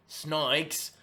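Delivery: "snikes" with the Australian vowel for snakes; Aussie accent. Shown as a demonstration. Fight picks host trying one Aussie word, Australian accent. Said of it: "I can say certain words in an Aussie voice".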